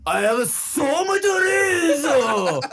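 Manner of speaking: put-on high laughing shouted Japanese accent. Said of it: "but with a Japanese accent".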